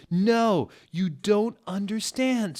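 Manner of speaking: high desperate cry; high, loud pleading scream; sad scream. Acted. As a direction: plead loudly to be understood. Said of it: "screamed Draco sadly".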